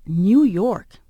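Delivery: big, loud punch on the city name; emphatic bigness. Retold or imitated. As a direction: make New York sound huge. Contrasting big city for Dad.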